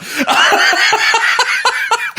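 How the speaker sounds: breathless high laughter; high laughing fit. Real, not acted. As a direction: burst out laughing. Laughing after quoting coworker.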